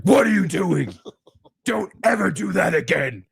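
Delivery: retold angry scolding, pitched high; unleashed demon voice. Dad re-enacting scolding his son over the TV, angry outburst. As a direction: burst out sharply at the child, re-enacted. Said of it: "unleashed a voice I don't use on that boy".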